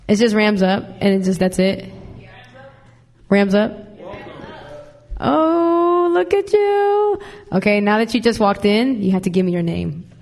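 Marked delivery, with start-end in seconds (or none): 5.2-7.2 very high, drawn-out sing-song greeting; gushing greeting. Real, not acted. Host greets a student who just walked in, high sing-song gushing. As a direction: coo it way up high and slow.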